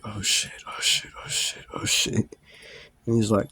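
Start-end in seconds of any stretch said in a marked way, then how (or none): 0.0-2.4 unvoiced panicked whisper. Waiting on the cop, panicked whispered cursing. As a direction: breathe it out in a scared whisper.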